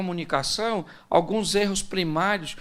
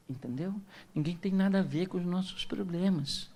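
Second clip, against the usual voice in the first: hushed scolding; 10 dB quieter than usual. Retold or imitated. Husband scolding his wife that nobody should hear, hushed. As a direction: keep the quarrel secret from the neighbours. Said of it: "O marido fala pra ela assim".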